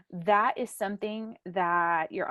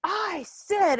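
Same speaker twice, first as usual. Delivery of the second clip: higher, louder mocking the boy; imitating teen defiance; 10 semitones higher, 5 dB louder than usual. Aunt mimicking her defiant nephew, higher and louder. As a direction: play the cocky teenager. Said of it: "he was like".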